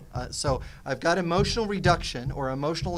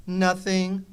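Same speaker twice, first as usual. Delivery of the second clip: mock sulky answer; 4 semitones higher, 7 dB louder than usual. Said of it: "I will get two very bad answers".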